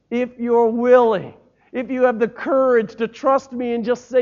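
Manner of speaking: high, loud, urging divine voice; God answering the doubter, loud and high; God's encouraging voice. Acted. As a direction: call them out of doubt, strongly.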